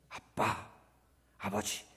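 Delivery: whispered, tender address; soft intimate calling to father; hushed prayer. Acted. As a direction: breathe it like a prayer.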